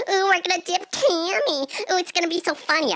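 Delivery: high, mocking imitation of boys; mocking teenage boys. Teenage parasail crew plotting a dunk, mocking imitation. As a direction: sneer it like gleeful pranksters. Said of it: "'cause they were young boys".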